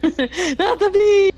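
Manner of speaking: high, drawn-out laughing yell; mock scream. Player mimics Eamon's cry about the bees, mock scream. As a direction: parody a panicked cry, loud and long.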